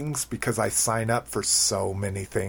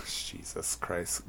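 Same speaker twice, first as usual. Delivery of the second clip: muttered curse; 4 semitones lower, 15 dB quieter, slower than usual. Real, not acted. Flubs the line, curses under breath.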